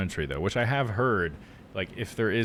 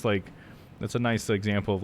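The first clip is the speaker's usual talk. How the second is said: ordinary talk, nothing marked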